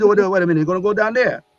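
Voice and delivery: high, loud Jamaican disbelief; Jamaican accent. Retold or imitated. Archangel can't believe Gabriel's errand, loud Jamaican accent. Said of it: "he had, like, this Jamaican accent".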